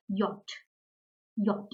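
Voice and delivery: yacht said as "yot"; German letter J. Shown as a demonstration. Teacher showing learners the German alphabet, J as "yot". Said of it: "letter J is pronounced as".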